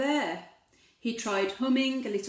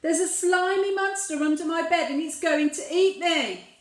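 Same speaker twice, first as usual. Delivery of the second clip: higher, louder panicked plea; frightened squeal; 6 semitones higher, 6 dB louder than usual. Acted. Little Bear telling Big Bear of the monster, higher panicked plea. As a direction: sound the alarm like a scared cub. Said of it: "squealed Little Bear".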